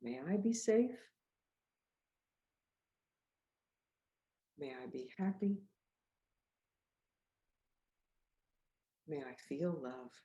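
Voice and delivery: calm loving affirmation; gentle self-wishes. Shown as a demonstration. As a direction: lead children gently. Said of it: "Let's say this together".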